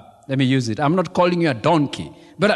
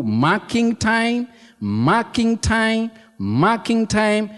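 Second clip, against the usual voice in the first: 1 slow emphasis; 4 semitones higher, slower than usual. Acted. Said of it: "repeats phrase slowly for emphasis".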